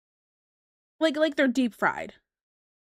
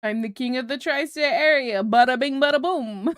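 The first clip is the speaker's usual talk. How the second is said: boastful mock Jersey wiseguy, getting louder; Jersey accent; 5 dB louder than usual. Tony boasting on the Titanic, hammed-up Jersey accent. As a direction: proclaim it in hammed-up Jersey, punching the end.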